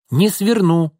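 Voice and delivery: high, cheerful retort; little girl brushing off the warning gleefully; merry child. A narrator's reading. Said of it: "весело рассмеялась Алёнка". (весело, детским голоском)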